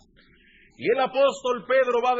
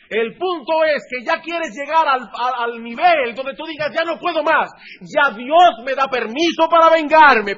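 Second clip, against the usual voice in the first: loud, high, fiery preaching; preaching shout; 6 semitones higher, 10 dB louder than usual. Real, not acted. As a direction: drive the point home at full force.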